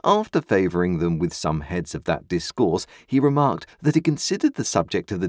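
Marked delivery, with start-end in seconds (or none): none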